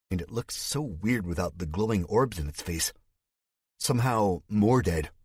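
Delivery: usual speaking voice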